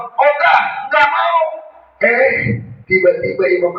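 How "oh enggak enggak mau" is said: stubborn refusal. Retold or imitated. Dying imam refusing his son's prompting, stubborn. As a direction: blurt it out as a dying old man.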